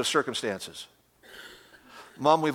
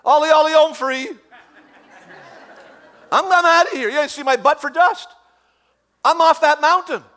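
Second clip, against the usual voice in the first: very high, loud hammed-up fleeing boy; mock panic of the boy on the altar; Isaac fleeing; 12 semitones higher, 6 dB louder than usual. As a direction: squeal it like a kid running off. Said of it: "I'm like".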